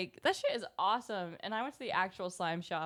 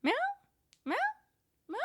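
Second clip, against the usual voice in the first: cat meows; much faster than usual. Retold or imitated. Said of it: "she just starts going".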